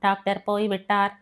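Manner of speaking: plain reading voice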